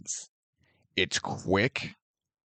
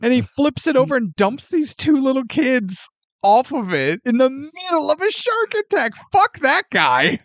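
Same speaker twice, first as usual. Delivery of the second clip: indignant outrage; 10 semitones higher than usual. Real, not acted. Indignant rant about the man.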